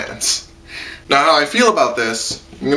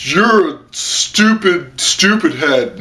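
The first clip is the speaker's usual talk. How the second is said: put-on slow, taunting childish insult; mock bully; 5 semitones higher, slower than usual. Reviewer mimicking an old bully, slow and taunting. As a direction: drag out the playground taunt. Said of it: "this dude who's like".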